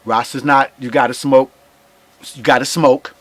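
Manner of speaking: usual speaking voice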